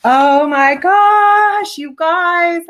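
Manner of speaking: high, loud, drawn-out exclamation; overwhelmed delight. Real, not acted. Vlogger seeing her handmade notebook, overwhelmed delight. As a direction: cry out slowly in stunned delight.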